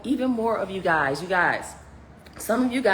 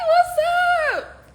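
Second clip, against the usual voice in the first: very high, louder squealed greeting; squealed hello; 4 dB louder than usual. Real, not acted. Greeting her live viewers with a high squeal.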